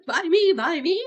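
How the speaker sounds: goofy sing-song hawking voice; silly ad voice. Retold or imitated. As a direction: squeal it like a flapping inflatable ad figure. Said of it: "advertisement things that look really funny".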